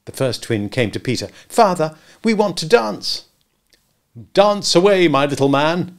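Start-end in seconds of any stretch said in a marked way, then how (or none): 1.5-3.2 put-on high, loud pleading child. Twin begs father. 4.3-6.0 loud, jovial father; high good humour. A narrator's reading. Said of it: "in high good humour".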